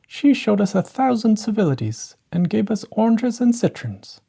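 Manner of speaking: showing off about the ball; boasting stepsister. A narrator's reading.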